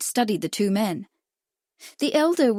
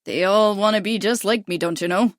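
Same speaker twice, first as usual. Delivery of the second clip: Midwestern drawl. A narrator's reading. American jockey greeting Lily, in a light Midwestern drawl. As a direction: drawl it in a Midwestern accent, cocky. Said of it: "he drawled in a broad Midwestern accent".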